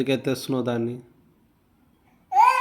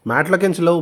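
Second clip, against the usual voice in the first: raised, louder parental order; stern command; 6 semitones higher, 7 dB louder than usual. Real, not acted. Parent ordering a child off the mat, stern command. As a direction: order it sharply, voice pitched up.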